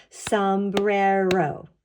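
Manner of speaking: SOMBRERO very slowly, in three stretched beats; clapped out syllables. Shown as a demonstration. Teacher clapping out a word with children, syllable by syllable. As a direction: draw out each syllable of Sombrero. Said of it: "fun word to clap out".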